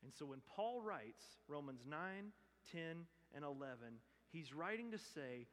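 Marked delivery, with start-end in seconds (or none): none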